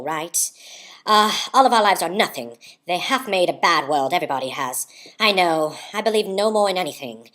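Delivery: theatrical, emphatic monologue voice; Katharine Hepburn. Acted. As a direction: declaim it with grand disdain. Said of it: "read it like Katharine Hepburn would".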